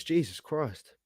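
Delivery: ordinary talk, nothing marked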